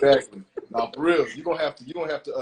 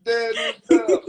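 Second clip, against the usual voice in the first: laughing approval; 4 semitones higher, 9 dB louder than usual. Real, not acted.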